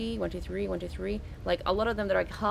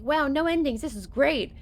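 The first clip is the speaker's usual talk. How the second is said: excited mock cheer; 6 semitones higher, 4 dB louder than usual. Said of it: "It's like".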